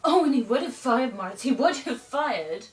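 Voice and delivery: loud, swooping theatrical insistence; emphatic outcry. Acted. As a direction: burst out loudly, insisting he believe you. Monologue climax, insisting to Moritz.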